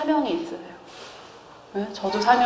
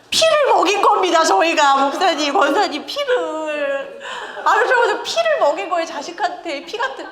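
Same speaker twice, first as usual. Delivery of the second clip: exclaiming; 6 semitones higher than usual. Real, not acted. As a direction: cry it out with amazement. Exclaiming to congregation.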